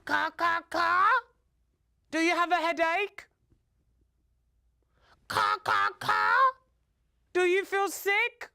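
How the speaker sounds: high, urgent caws, each translated into a question; anxious questioning crow. Acted. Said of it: "Papa Crow didn't know what was wrong. He asked her".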